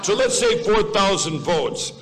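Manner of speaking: chuckling while settling on the number; amused, laughing correction; laughing restatement. Real, not acted. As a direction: repeat the figure with a laugh in it. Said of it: "Who's gonna correct me?".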